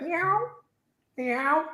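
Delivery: high little mock meows; cat meow. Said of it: "he was like".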